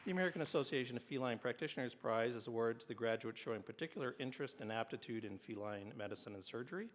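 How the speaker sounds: formal ceremony announcer; award announcer. Acted. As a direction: read it like an awards presenter. Reads award citations at ceremony.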